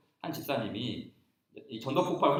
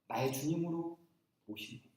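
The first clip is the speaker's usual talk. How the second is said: ordinary talk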